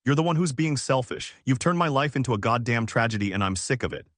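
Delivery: usual speaking voice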